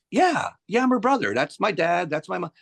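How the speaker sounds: high, excited imitation of the brother; eager confirmation. Brother points out his family to the singer, eager.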